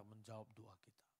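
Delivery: whispered question. Real, not acted.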